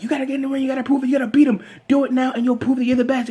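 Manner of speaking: high-pitched, rapid-fire nagging woman; imitated pep talk. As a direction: pump him up in a fast, high put-on voice. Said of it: "like that, pumping him up".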